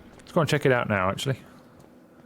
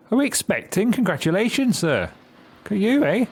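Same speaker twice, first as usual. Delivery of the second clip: higher, lilting delight; gushing congratulations; 12 semitones higher, 4 dB louder than usual. Acted. Game character congratulating someone on expecting, gushing. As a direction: gush with swooping high pitch.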